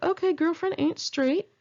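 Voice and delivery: high, sassy put-on voice with attitude. Narrator reacting to her coworker's scarf story, high sassy quip. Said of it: "I was like".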